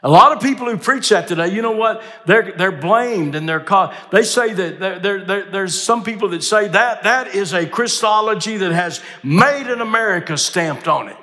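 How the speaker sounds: high, quick, forceful pulpit voice; impassioned preaching. Real, not acted. Preacher reporting critics of this Christology, impassioned.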